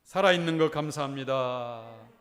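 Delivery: slow, sincere thanks to the person beside you; a heartfelt greeting to your neighbour in the pew. Shown as a demonstration. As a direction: say it looking them in the eye, slowly. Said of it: "옆에 인사합시다".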